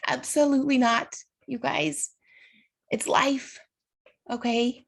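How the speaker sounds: high, tearful voice holding firm; sort of crying. Real, not acted. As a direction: insist through real tears. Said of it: "I'm sort of crying in front of you".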